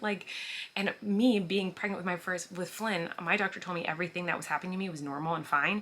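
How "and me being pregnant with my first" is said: ordinary talk, nothing marked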